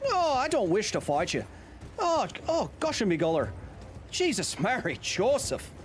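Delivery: high, hammed-up Irish lilt; Irish accent. Shown as a demonstration. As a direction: show off a playful high-pitched Irish accent. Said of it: "I can do an Irish accent".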